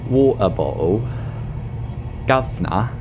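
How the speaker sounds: mock British accent with dropped consonants; put-on British accent, word by word.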